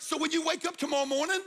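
high, punchy retold exclamation; imitating a man telling his friend to live it; blunt advice. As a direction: throw it out like a friend's sharp wake-up call. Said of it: "He was like".

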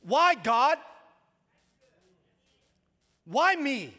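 loud, high, anguished plea; anguished cry to God. Retold or imitated. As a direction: throw it up to heaven in despair. Voicing a despairing cry to God.

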